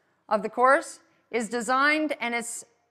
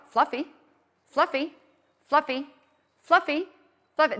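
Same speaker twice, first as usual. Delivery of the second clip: repeated calling of dog; 4 semitones higher than usual. Shown as a demonstration. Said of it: "saying my name over and over".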